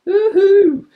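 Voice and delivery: high, loud whoop of joy; cheering woohoo. Real, not acted. Host cheering that the show is officially sponsored. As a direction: whoop it out in celebration.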